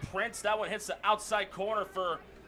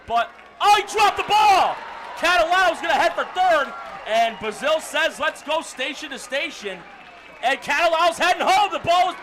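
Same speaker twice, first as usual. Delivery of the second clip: loud, high live baseball call; excited play-by-play; 7 semitones higher, 10 dB louder than usual. Real, not acted. Announcer calls runners heading home, excited shouted play-by-play. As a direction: call the dropped ball at a shout.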